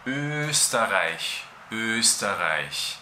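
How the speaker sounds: Österreich slowly with a long front rounded Ö. Shown as a demonstration. Teacher repeating Österreich slowly for learners. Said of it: "Voy a escribirlo aquí".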